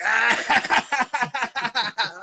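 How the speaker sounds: loud, high imitation of laughing friends; hammed-up mocking laughter; mock cackle. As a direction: burst into exaggerated high "ah-ha-ha".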